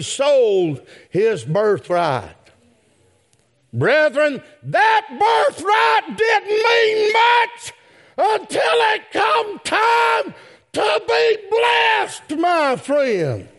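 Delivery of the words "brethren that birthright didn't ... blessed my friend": much higher, slow shouted preaching; preaching at full force. Acted. Preacher to congregation, crying out about Esau's lost birthright. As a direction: stretch it out in a high preacher's cry.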